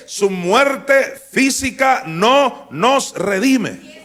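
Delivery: loud, slow, high and forceful; shouted pulpit declaration; fiery preaching. Acted. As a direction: make hell tremble with each word. Preaching climax about hell.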